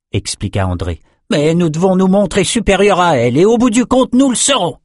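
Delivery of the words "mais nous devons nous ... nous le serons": high, emphatic voice climbing higher to the end; determined sorcerer. Acted. Sorcerer vows to outdo his own beasts, rising determined delivery. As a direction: build to a loud, confident vow.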